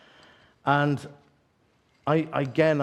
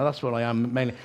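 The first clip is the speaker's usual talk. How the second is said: ordinary delivery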